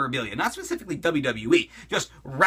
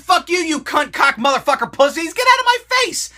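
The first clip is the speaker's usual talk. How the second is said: mock shouting, pitch jumping way up and louder; retold angry outburst; 11 semitones higher, 6 dB louder than usual. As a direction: act out telling them off, yelling high. Retold telling off producers.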